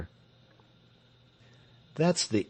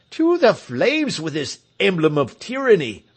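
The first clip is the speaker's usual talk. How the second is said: crowd cry; 6 semitones higher, 6 dB louder than usual. Acted. Crowd cries against tyrant's hat.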